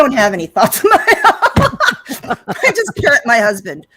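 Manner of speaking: laughing through a joke about having no thoughts; giggling self-deprecation; mock self-mockery, laughing. Guest joking to hosts about parroting her husband, laughing. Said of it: "I'm just an empty vessel".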